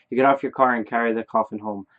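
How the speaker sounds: ordinary talk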